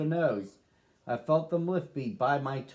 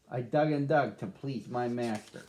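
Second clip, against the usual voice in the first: ordinary delivery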